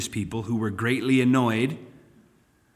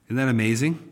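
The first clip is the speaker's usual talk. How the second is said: ordinary talk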